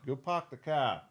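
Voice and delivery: Boston accent. Shown as a demonstration. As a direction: try it softly with Boston dropped r's. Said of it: "then Bostonian".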